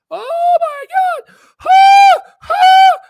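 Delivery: high, loud mock shrieking whine; hammed-up panicked investor, squealing and cackling; mocking upset retail investor. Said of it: "they're, like, upset".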